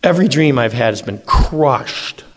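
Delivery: ordinary talk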